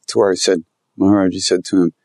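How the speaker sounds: normal talking voice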